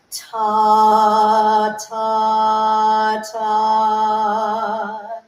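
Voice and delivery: "Ta" with long held open ah, loud; elongated vowel. Shown as a demonstration. Voice teacher demoing singers' "Ta", long held ah. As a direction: sustain the ah in each "Ta". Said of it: "you elongate the vowel".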